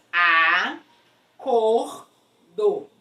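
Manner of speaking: "A, cor, do" as three separate beats; syllable by syllable. Shown as a demonstration. Teacher separating the syllables, syllable by syllable. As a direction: pause between "A", "cor" and "do". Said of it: "Separando a sílaba".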